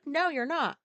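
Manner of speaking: normal talking voice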